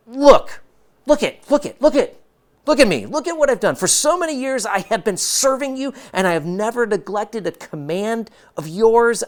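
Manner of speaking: put-on high, loud, indignant older son; high and loud aggrieved rant; angry complaint. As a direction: whine it out like a resentful brother. Older son's angry complaint to father.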